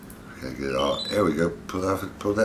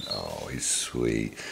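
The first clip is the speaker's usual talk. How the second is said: tender; 6 semitones lower, 6 dB quieter, much slower than usual. Real, not acted. Cooing over pet.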